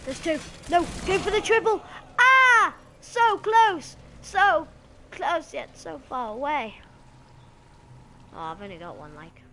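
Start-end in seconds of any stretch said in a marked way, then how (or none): 0.1-1.8 loud, high, building excitement; excited urging. Real, not acted. Gamer going for a triple kill, excited shouting. 2.2-2.7 loud, high open yell; frustrated scream. Real, not acted. Gamer missing a triple, frustrated high yell. 3.0-6.8 loud, high cry easing down; anguished lament. Real, not acted. Gamer narrowly missing a triple, loud lament.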